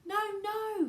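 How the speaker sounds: high, loud acted refusal; yelling back. A narrator's reading. Wonka yells back over the wind, high and loud. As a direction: shout them down. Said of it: "yelled Mister Wonka back".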